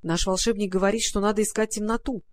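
ordinary delivery

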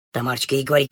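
ordinary delivery, nothing marked

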